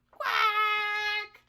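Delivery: high, stretched duck quack; drawn-out quack. A narrator's reading. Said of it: "he roared a mighty quack".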